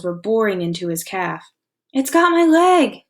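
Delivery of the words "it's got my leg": high, loud, slow cry of pain; agonized scream. Acted. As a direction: scream as the shark bites. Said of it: "Chet screamed".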